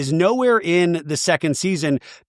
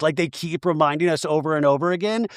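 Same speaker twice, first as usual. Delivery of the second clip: usual speaking voice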